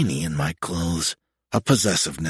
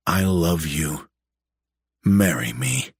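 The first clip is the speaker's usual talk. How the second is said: tender; 5 semitones lower, slower than usual. Acted. Tender proposal in low voice.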